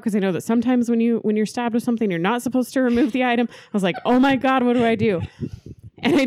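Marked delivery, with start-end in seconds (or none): 4.0-5.2 retold panic. Panic over stabbed sister.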